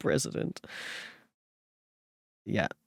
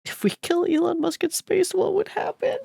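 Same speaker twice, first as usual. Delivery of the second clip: very high, cartoonish squeak; silly squeaky voice; 4 dB louder than usual. Streamer asks chat a joke in a silly squeaky voice.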